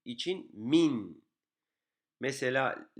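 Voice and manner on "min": "min" held on its final n; "min" as one slow closed syllable; stopping on the nun. Shown as a demonstration. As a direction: rest on the n closing "min". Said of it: "nun üzerinde cezim olduğu için".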